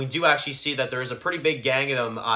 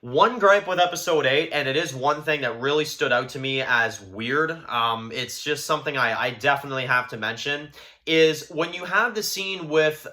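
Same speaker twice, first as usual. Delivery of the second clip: ordinary delivery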